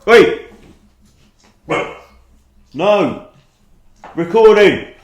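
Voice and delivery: loud, high one-word commands; scolding shout. Real, not acted. Streamer to dogs during recording, scolding shout. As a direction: shout the dogs down in short bursts.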